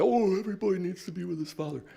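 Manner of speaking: high, fast sneering mimicry; mock their pious line with scorn; parroting the regime in a high sarcastic voice; mocking sanctimony. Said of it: "And here they say".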